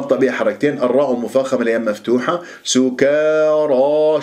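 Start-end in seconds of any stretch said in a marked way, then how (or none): 2.6-4.2 سكارى chanted slowly, light كا, full dark را; heavy final alif. Shown as a demonstration. Teacher models the word, heavy ra and alif. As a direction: thicken the ending of سكارى. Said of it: "فخمت تبعا للراء".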